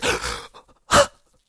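big breathy gasp of disbelief; shocked gasp. Real, not acted. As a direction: gasp hard in surprise. Startled on pulling a card.

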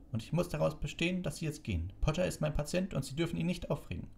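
high-pitched, insistent matron; indignant. A narrator's reading. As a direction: lay down the law for your patient. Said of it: "sagte Madame Pomfrey empört". (empörte Krankenschwester, hoch)

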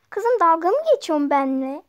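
loud, scolding mother yelling; angry shout. Acted. Mother yelling at her daughter over the ice cream. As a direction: blow up at the cheeky daughter.

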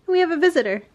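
ordinary delivery

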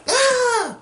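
high-pitched imitated scream; mock scream. Everyone reacts to the destruction, high mock scream.

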